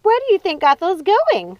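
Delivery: high loud screech; startled screeching question. A narrator's reading. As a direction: shriek the question. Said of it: "screeched Ruby".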